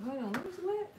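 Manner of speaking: sudden high confused what. Real, not acted. Reading a viewer comment, she stops in sudden high confusion. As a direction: pitch jumps up in real confusion.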